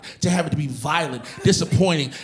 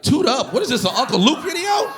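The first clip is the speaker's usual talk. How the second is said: incredulous; 9 semitones higher than usual. Retold or imitated. Incredulous at masseuse's request.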